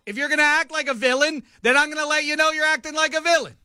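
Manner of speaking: high self-righteous defense; defiant. Real, not acted. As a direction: insist you were right to call her out. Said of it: "Was that wrong?".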